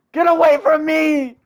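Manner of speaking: high, loud and slow shriek; shrieking get away; panicked shout. Real, not acted. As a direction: scream it away in real panic. Panicked as Natsuki moves close.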